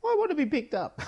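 put-on very high-pitched little kid plea; whiny kid. Kid begging a parent to be picked up, high childish whine. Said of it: "that moment as a kid where you're like".

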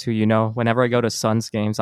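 usual speaking voice

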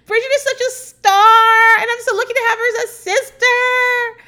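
high, loud gushing sing-song; mock Anastasia. Anastasia praising Bridget on camera, mock high gushing. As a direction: gush over your sister like a starstruck fan. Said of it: "and she's like".

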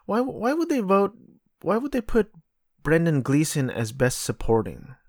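high-pitched, baffled questioning; incredulous. Real, not acted. Baffled by an award category choice.

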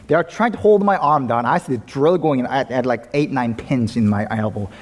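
usual speaking voice

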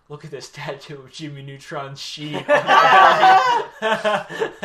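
laughing loudly mid-sentence; laughing through it. Retold or imitated. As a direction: giggles breaking the words. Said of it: "and then it was".

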